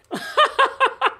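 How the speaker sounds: high burst of cackling laughter; laughing. Real, not acted. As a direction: cackle out loud, high and gleeful. Laughs at green weenie arriving.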